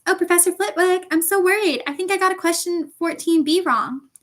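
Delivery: high, loud, mock-worried whine; put-on high voice. A narrator's reading. Boy mocks his friend worrying to teacher, high voice. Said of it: "He put on a high voice".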